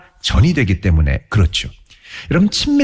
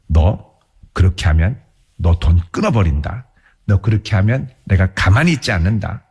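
lower, mock-threatening parent; 6 semitones lower than usual. Parent threatening to cut off money, low mock threat. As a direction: warn the child, voiced lower and stern. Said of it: "협박하기 시작하는 거죠".

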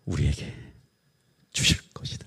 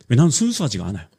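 ordinary delivery

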